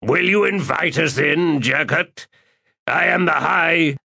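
high, proud, commanding voice; imperious warlord. Acted. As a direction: lord it over the hermit. Calor again.

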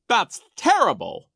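high, loud outcry; crying out. A narrator's reading. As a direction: exclaim in theatrical outrage. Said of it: "Nero cried".

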